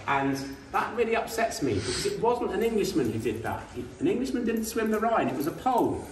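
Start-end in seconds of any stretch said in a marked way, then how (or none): none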